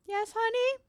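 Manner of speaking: high, singsong mom voice; sweet mother. Acted. Guy's mother answers on speakerphone, high and sweet. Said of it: "Puts on speakerphone".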